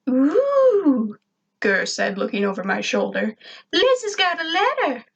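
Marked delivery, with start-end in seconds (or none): 0.1-1.2 much higher and louder ooh; delighted robot coo; robot Gur ooh. A narrator's reading. Gur looks over his master's shoulder, delighted. 3.7-5.0 much higher, teasing robot voice; robot Gur. A narrator's reading. Gur teases his master about Liz's letter, gleeful.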